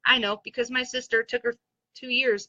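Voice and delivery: ordinary talk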